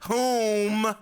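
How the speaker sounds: loud, high, pedantic correction; hammed-up drawn-out correcting "whom"; mock grammar snob. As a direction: imitate a snob honking out "whom". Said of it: "those bitches who are like".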